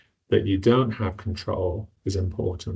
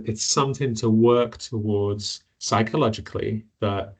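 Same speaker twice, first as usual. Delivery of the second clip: usual speaking voice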